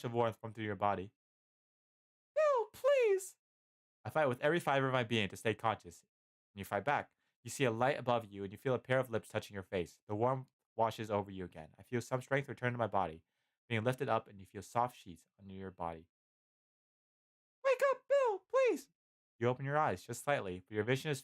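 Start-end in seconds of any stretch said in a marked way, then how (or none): 2.3-3.4 very high falsetto plea, louder; pleading Licia. A narrator's reading. Licia tries to rouse dying Bill, pleading falsetto. 17.6-18.9 very high falsetto plea, louder; pleading Licia. A narrator's reading. Licia tries to rouse dying Bill, pleading falsetto.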